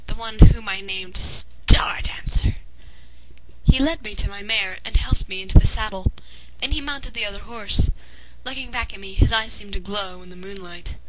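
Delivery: usual speaking voice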